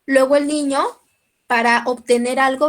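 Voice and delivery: normal talking voice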